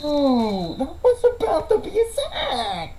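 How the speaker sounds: high excited yelp. Real, not acted. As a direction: squeal at the near sack. Excited near sack.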